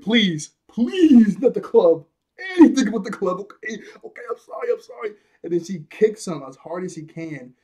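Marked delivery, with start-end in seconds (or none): 0.0-3.4 loud, high, hammed-up begging; panicked pleading. Koby begging Alvida not to club him, loud mock panic. 3.4-5.1 high, pleading mock whimper; frantic apology. Koby apologising to Alvida to escape a clubbing, high mock pleading.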